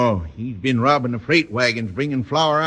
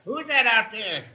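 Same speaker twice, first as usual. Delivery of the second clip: calling out from another room; 11 semitones higher than usual. Acted. Father calls from the bedroom.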